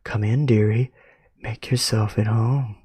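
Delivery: soft polite voice. Acted. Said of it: "a soft and polite voice".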